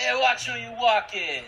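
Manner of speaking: drawn-out, loud New York Italian shout; New York Italian accent. Shown as a demonstration. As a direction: holler like an indignant New York Italian pedestrian. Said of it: "more of a New York accent".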